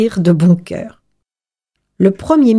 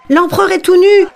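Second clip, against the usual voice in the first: high, raised storyteller's shout; crowd's cry; 10 semitones higher, 3 dB louder than usual. A narrator's reading. Crowd passes on the child's discovery, crying out high. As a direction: call it out high to everyone. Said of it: "qui se mit à crier".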